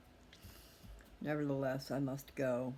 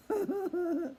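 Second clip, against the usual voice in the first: a quick high rueful laugh; brief real laugh; short laugh; 7 dB louder than usual. Real, not acted. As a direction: laugh at your own bind. Laugh after needing truck buyer.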